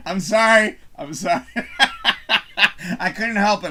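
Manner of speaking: laughing through the sorry; laughing apology. Real, not acted. Man laughing as he apologises to viewers. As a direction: giggle while you apologise.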